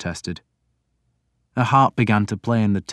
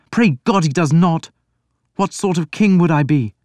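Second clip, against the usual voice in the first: put-on higher, louder young prince voice; a troubled prince pleading; Alfred, anguished prince; 9 semitones higher, 5 dB louder than usual. A narrator's reading. Reader voicing Alfred to his priest, higher and louder.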